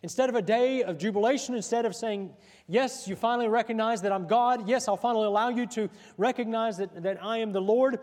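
full-voiced sermon delivery; projected, rolling pulpit cadence; preaching. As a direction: preach it out with driving emphasis.

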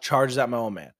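normal talking voice

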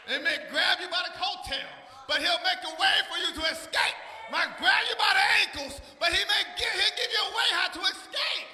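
loud, rising pulpit shout; full-force preaching. Real, not acted. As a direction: drive it home to the congregation at full voice.

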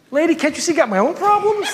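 high, laughing mock complaint; exasperated inner whine. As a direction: whine it, half laughing at yourself.

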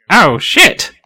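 high, jumpy exclamations; flustered Eddie. Acted. Eddie taking a turn in battle, flustered high outburst. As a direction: blurt it out, pitch leaping, then rally. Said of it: "Eddie goes".

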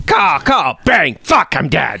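high screeching crow caws, then dying; shrill screeching bird woman. Pretend. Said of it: "Let me do a quick impression for you".

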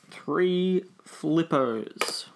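slow dictation to yourself; drawn out while writing. Real, not acted. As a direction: stretch each word as you write it down.

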